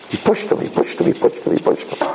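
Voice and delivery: whispered, repeated like a chant. Acted. Rabbi stressing the coach's drive, whispered. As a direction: breathe the words out with no voice.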